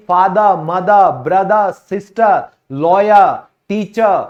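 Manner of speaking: words said without the final r sound; फादर, मदर, ब्रदर, सिस्टर, लॉयर, टीचर all ending open, no r at the end; silent final r. Shown as a demonstration. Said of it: "इनको आप आज के बाद ऐसे बोलो कि".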